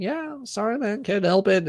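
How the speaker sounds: high, light put-on voice; playful high mock apology; mock apologetic reply. Mock reply to outraged users.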